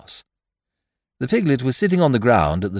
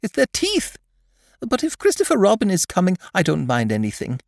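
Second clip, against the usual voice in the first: high, earnest little piglet; timid little creature, pitched well up; 10 semitones higher than usual. Acted. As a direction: confess a worry earnestly in a high small voice. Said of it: "said Piglet earnestly".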